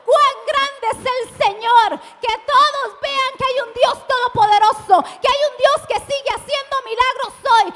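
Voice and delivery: loud, high, driving proclamation; full-force preaching. Real, not acted. Pastor declares God's greatness to the congregation, impassioned preaching. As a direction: preach it to the whole crowd at the top of your voice.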